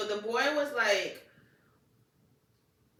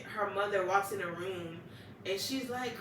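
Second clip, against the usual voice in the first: ordinary talk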